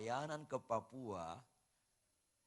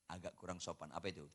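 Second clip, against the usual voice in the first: ordinary talk, nothing marked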